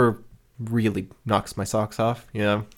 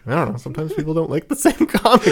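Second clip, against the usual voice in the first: higher, breaking into a laugh; laughing reassurance; 6 semitones higher than usual. Real, not acted. Host reassuring listeners about differing tastes, amused and laughing. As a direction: laugh it off as you say it.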